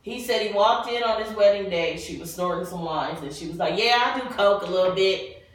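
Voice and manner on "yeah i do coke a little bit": ordinary talk, nothing marked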